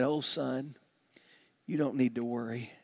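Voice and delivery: soft, low, soothing dad; calm a frightened child gently; quiet, comforting aside to a son; acted fatherly reassurance.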